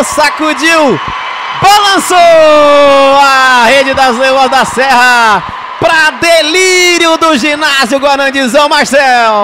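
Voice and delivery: long stretched roaring goal shout; explosive goal commentary. Real, not acted. Goal call, crowd delirium. (grito de gol longo e explosivo)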